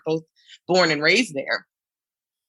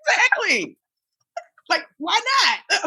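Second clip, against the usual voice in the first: excited squeal; 14 semitones higher than usual. Real, not acted. Laughing agreement, very high.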